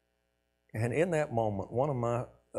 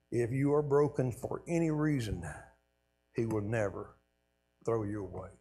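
usual speaking voice

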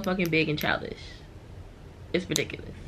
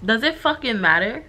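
louder and higher, fed up; irritated outburst; 5 semitones higher, 6 dB louder than usual. Real, not acted. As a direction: snap at the absent cousin in disbelief. Annoyed aside about cousin's question.

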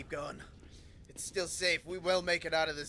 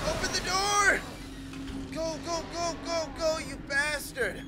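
frantic yelling; 9 semitones higher, 6 dB louder than usual. Real, not acted. Fleeing, urging.

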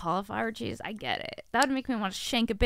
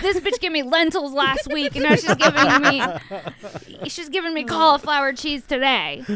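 louder, higher griping in a comic huff; mock angry rant; 5 semitones higher, 9 dB louder than usual. Imagining the husband raging over lentils and cauliflower, mock angry rant. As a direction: rant it loudly, playing the aggrieved husband. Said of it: "He was like".

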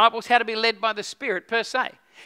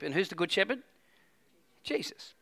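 ordinary talk, nothing marked

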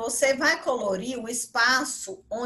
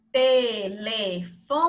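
TELEFONE very slow, syllable by syllable; separado em sílabas; 3 semitones lower, 4 dB louder, much slower than usual. Shown as a demonstration. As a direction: break "Telefone" into four drawn-out syllables. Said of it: "separar em sílabas". ("Telefone" pausado, sílaba por sílaba)

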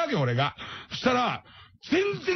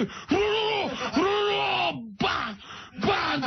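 angry beluga; 8 semitones higher than usual. Retold or imitated. Beluga raging at Tashiro through the glass, trilled creature roar. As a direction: roll it out like an enraged sea beast.

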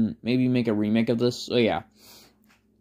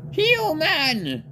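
Papyrus voice; 14 semitones higher than usual. Acted. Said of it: "when Papyrus noticed Deku, he said".

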